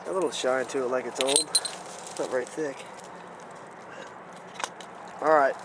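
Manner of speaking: ordinary talk, nothing marked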